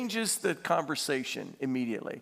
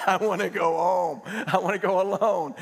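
fed-up outburst played for laughs; hammed-up weary complaint; mock exasperation; 2 semitones higher, 4 dB louder than usual. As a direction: act sick and tired of them. Imagined fed-up Jesus outburst.

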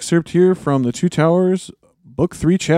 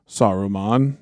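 ordinary talk

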